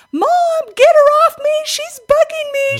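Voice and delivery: high-pitched, very loud mock child scream; shrieking imitation of a child's complaint; screaming kids. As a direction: yell like a squabbling kid tattling to Mom. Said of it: "my kids come running in the room screaming".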